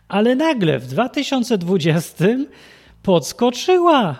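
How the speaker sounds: slow, high, mock-dramatic irony; sarcastic. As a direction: drag out the irony. Ironic dramatic reveal.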